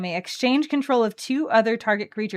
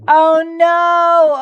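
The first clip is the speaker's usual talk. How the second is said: mock dismay; 8 semitones higher, 11 dB louder than usual. Mock dismay over lost combo.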